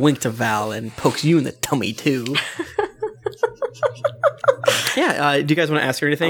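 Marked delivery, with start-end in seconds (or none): 2.4-4.9 long high giggle; giggly high laugh. Acted. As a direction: giggle like a tipsy lady. GM laughs in character.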